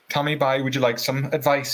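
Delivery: retold line in a Cork accent. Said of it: "he said, in his Cork accent".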